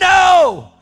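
huge high anguished scream; reliving a meltdown, full-voiced; freaking out. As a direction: scream the word in refusal. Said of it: "I'm freaking out, like".

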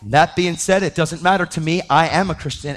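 ordinary talk

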